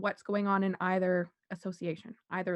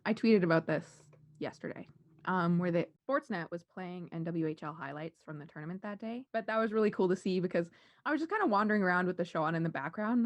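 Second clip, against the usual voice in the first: ordinary talk, nothing marked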